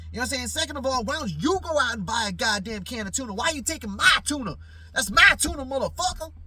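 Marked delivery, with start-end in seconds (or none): 1.8-6.3 high, loud accusing rant; furious rant. Retold or imitated. Scolding the roommate who fed his tuna to the cat, furious and loud. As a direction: tear into him over the tuna.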